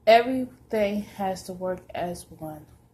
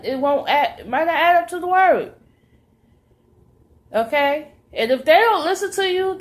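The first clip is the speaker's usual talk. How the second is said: high, loud preaching to the flock; fiery preaching; 6 semitones higher, 6 dB louder than usual. Acted. Preacher urging believers to study the word, loud and high. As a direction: preach it out full force to the saints.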